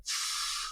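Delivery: long breathy exhale; imitated drag and blow of smoke; mimed smoke puff. Storyteller mimics the puffing mother, long exhale.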